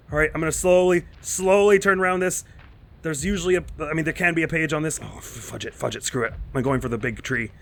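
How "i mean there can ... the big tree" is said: ordinary talk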